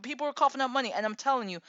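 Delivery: usual speaking voice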